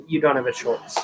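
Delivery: ordinary talk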